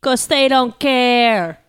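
slow, stretched-out words; drawn-out answer. Pretend. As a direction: drag each word out for comic effect. Blunt drawn-out answer to why not.

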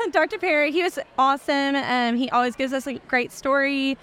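ordinary delivery

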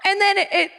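usual speaking voice

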